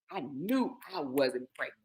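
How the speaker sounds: low, hushed restatement; quiet certainty. Real, not acted. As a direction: drop right down, privately sure of it. Repeats claim, dropping voice.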